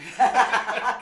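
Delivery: a big real burst of laughter; loud laugh. Real, not acted. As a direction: laugh hard at the joke. Laughing at partner's axe joke. (lauter Lachanfall über den Witz)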